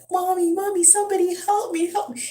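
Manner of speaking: high, loud crying plea for help; crying child. Retold or imitated. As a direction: wail for mommy like a scared little boy. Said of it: "He starts crying".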